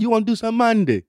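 imitating a London dealer saying "Monday" for Mandy; London dealer offering Mandy in a mock London accent. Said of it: "They called it Monday out there".

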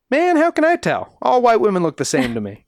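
high, incredulous put-on voice; sassy witness. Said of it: "she was asked by the police".

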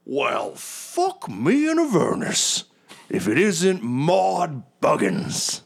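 high, booming heroic blowhard, drawn out; boisterous adventurer. Acted. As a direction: greet an old rival with huge slow gusto. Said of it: "and he goes".

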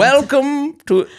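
high, slow, put-on old British welcome; mock old English. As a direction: play a Gilded Age English host. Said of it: "I feel like you're doing old English".

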